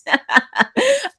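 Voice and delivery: sudden high laugh; high burst of laughter. Real, not acted. Teacher laughing at a student's cheeky sentence about the president.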